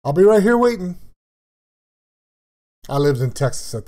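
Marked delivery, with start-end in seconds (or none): 0.0-1.0 fond imitation of dad, higher and louder; lightly voiced dad calling out his promise; imitating dad. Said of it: "His favorite thing to tell me was".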